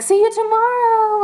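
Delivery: high, loud shout after the singer; excited call-out. Retold or imitated. Fans calling goodbye to the singer, a high excited shout. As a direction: call it out to the leaving idol, bright and loud.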